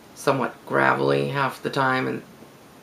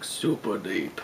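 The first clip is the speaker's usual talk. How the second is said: mock deep voice; 5 semitones lower, 7 dB quieter, slower than usual. Shown as a demonstration. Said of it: "their voices are, like".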